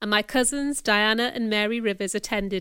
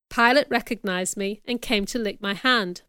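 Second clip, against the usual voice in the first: ordinary delivery, nothing marked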